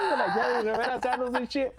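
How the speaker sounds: high, laughing voice; real laughter carrying the pitch way up; laughing while talking. Real, not acted. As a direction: crack up through the words. Laughing about the jail sandals.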